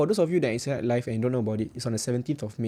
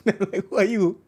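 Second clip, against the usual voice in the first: laughing through a high who are you; 8 semitones higher, 5 dB louder than usual. Real, not acted. Streamer mocking strangers who message him, laughing. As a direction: giggle out the question.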